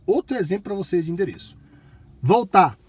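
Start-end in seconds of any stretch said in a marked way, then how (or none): 2.2-2.8 loud clear command; talking to voice assistant. Real, not acted. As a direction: speak up to the car.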